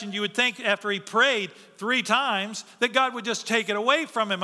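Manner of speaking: ordinary talk, nothing marked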